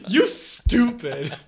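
laughing. Real, not acted. Laughing at armadillo joke.

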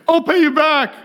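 high-pitched imitation of a grateful woman; insistent shopper. Lady offers to repay the stranger, high mock insistence.

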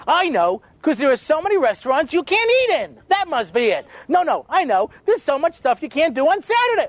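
high-pitched, sarcastically eager answers; mock eager guessing. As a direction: pitch it up like a keen pupil guessing.

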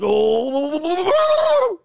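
high, rising wobbly wail; loud hammed-up "ooh" that climbs high; mock starving howl. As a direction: howl like you're comically starving. Acting out a starving man.

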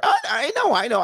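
very high imitated woman's voice; flustered girlfriend. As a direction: backpedal in a mock high-pitched voice. Girlfriend hastily backing down in retold quarrel.

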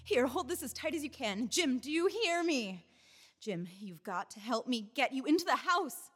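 ordinary delivery

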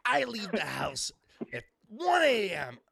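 high, loud mock proclamation; exaggerated father impression. Father boasting of an absurdly early start, mimicked high and loud. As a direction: bellow it as an over-the-top dad.

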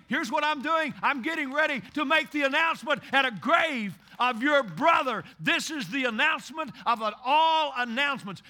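loud, ringing pulpit preaching; preaching at full force. Acted. Pastor rousing the congregation about Lazarus, full-force preaching. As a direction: drive it home to the congregation.

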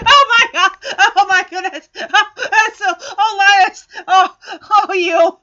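loud, laugh-choked gushing; hammed-up howling laughter; laughing fit. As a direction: crack up loudly over every word. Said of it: "he's just kinda snickering like".

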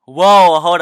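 loud startled whoa; surprised. Real, not acted. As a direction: blurt it out, caught off guard. Sudden objection.

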